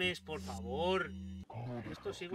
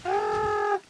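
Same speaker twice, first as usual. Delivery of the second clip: sudden high yelp; startled yelp; 12 dB louder than usual. Real, not acted. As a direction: yelp in fright. Startled cry in game.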